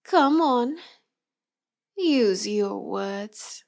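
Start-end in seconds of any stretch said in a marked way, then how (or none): 2.0-3.6 slow taunt. Acted. Taunting the silent captive.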